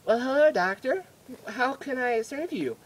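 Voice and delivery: high put-on female nurse voice; mock eager assistant; fawning nurse. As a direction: greet the doctor in a high, servile voice. Nurse greets the doctor.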